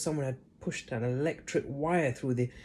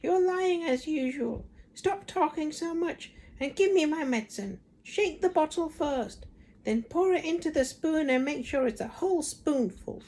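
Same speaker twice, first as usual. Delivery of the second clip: bossy Grandma. A narrator's reading. Grandma orders George to give her medicine. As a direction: order him about like a nasty grandmother.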